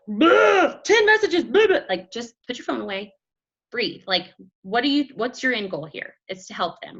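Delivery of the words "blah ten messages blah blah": higher, louder rapid blather; hammed-up overload; mock frantic flood. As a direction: spew it like a panicked texter. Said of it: "don't be like".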